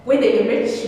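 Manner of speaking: normal talking voice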